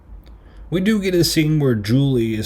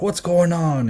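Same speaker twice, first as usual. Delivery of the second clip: mock alarmed parent; 8 semitones higher, slower than usual. Imitating parents rushing to the commotion.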